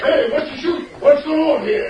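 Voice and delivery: calling out. Acted. Sheriff arrives calling out.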